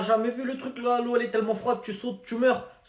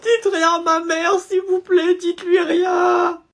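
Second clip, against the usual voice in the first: supplier en pleurant; 6 semitones higher, 5 dB louder, slower than usual. Acted. Élève supplie de ne rien dire.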